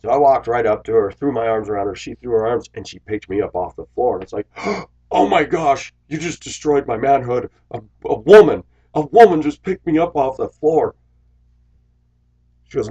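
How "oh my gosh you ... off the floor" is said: higher and louder, hammed-up dismay; mock shock. Driver mock-protesting after a woman lifts him, hammed-up shock. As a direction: play up fake outrage at being lifted.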